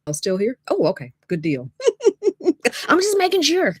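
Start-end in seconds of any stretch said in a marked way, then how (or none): 1.8-3.8 high, laughing reassurance; amused, voice climbing with laughter. Real, not acted. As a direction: say it through giggles, pitched right up. Laughing at own check-in.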